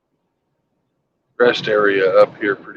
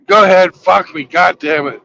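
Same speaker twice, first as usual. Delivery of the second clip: higher and louder resigned yell; mock angry surrender, raised and loud; fed-up outburst; 6 semitones higher, 4 dB louder than usual. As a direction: throw the words out in disgust, giving up. Hunter gives in angrily.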